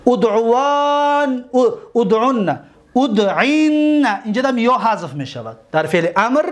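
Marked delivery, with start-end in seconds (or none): 0.1-4.1 ادعوان ادعون ادعین stretched slowly, each set higher; slow recitation. Shown as a demonstration. Teacher chanting the emphatic imperatives slowly to students. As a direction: hold every final نّ long.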